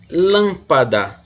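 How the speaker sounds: "lâmpada" slowed, with "lâm" punched loud; teacher's slow demo of "lâmpada", marking "lâm" as strong; stress on antepenultimate syllable. Shown as a demonstration. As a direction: hit the first syllable of "lâmpada" hard. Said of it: "antepenúltima".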